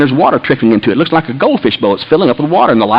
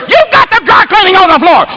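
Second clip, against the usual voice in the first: high, accusing shriek; outraged wife. Retold or imitated. Wife scolding husband over dry cleaning, outraged. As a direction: imitate a wife yelling an accusation.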